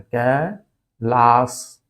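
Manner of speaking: कैलाश very slowly as kai, laas; correct, syllable by syllable. Shown as a demonstration. Teacher spelling out the correction, कैलाश in slow syllables. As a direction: split कैलाश in two, ending in plain s. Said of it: "होता है".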